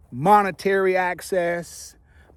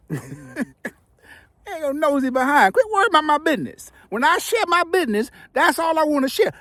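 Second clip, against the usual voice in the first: high, mock-stern teasing; playful scolding; 9 semitones higher than usual. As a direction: tease the audience for prying.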